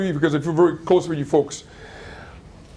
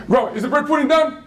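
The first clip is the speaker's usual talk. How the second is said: put-on eager child; 8 semitones higher than usual. Boy voiced asking grandma.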